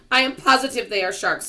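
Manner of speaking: loud, high insistence; alarmed and certain, pitched high; insistent Earthworm. A narrator's reading. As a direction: insist at the top of your voice. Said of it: "said Earthworm".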